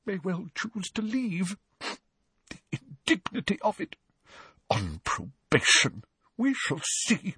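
soft, high indignant muttering; muttering threats. A narrator's reading. Tearful professor striding among pupils, muttering threats. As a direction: seethe under your breath, high and hushed. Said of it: "muttering what sounded like threats under her breath".

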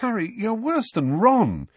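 high, drawn-out teasing; fond, incredulous scolding; amused exasperation. A narrator's reading. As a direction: tease slowly, pitch lifted. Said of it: "Hermione laughed".

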